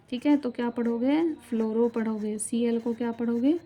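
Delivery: ordinary delivery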